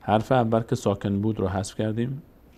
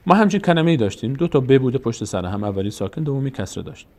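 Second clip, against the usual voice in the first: ordinary talk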